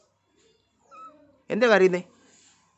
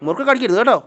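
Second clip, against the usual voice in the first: higher, louder firm warning; 6 semitones higher, 5 dB louder than usual. Real, not acted. Owner warning the dogs not to bite the snack, loud and firm. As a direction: warn them off the murukku.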